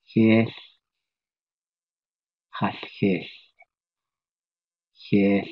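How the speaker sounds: "Hish" with a breathy lateral hiss; Navajo sound drill. Shown as a demonstration. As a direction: drill "Hish, hash, hish" slowly, hissing the end. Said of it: "I might as well learn it".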